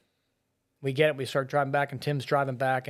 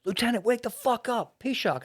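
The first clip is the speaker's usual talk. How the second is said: higher mock yell to snap him out of it; retold scream; 9 semitones higher than usual. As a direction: shout at the lieutenant to wake up. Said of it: "Tim screams".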